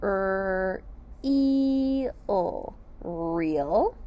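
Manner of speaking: real sounded Er, e, o then blended; sounding out. Shown as a demonstration. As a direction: sound out real one sound at a time. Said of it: "Let's sound out real".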